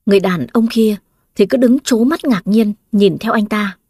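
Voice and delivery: ordinary delivery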